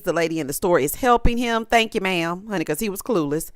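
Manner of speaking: normal talking voice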